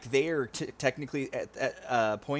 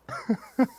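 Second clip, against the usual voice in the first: laughing; 11 semitones higher, 5 dB louder than usual. Real, not acted. Breaking character laughing.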